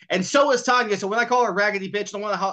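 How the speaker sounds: ordinary talk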